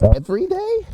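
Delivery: high squeaky disbelieving "every day?"; shocked disbelief. Retold or imitated. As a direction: react in shock at the chore. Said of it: "I was like".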